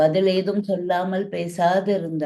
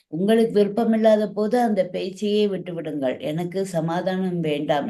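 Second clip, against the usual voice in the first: ordinary delivery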